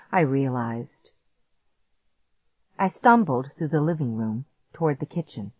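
plain reading voice

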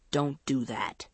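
low, understated snarl. A narrator's reading. Andrea warns her friend to stop, snarling. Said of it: "she snarled".

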